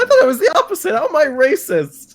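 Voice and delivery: high, laughing disbelief; stunned protest. Real, not acted. Player protesting the racism accusation. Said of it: "Okay, I'm breaking character".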